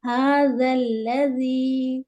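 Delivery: ہا ذل لذی chanted slowly with soft dh; slow recited ہا ذل لذی; soft zaal. Shown as a demonstration. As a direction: stretch the vowels of ہا ذل لذی, keeping ذ gentle. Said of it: "زال کو نرمی سے پڑھیں گے".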